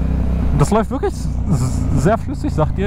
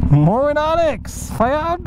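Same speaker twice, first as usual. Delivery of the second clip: slow, high, stretched "Moin"; drawn-out high greeting; 9 semitones higher, much slower than usual. Real, not acted. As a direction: call out a long, lilting hello. Greeting a passing rider.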